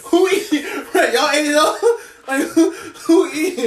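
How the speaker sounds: high and laughing; laughing mock question. Friend mocking the empty kitchen, laughing high. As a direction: keep asking between laughs.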